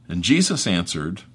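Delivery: ordinary delivery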